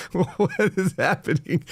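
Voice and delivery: high, slow, amused; incredulous giggle into the question; laughing disbelief. Real, not acted. Host reacting to a double article, laughing.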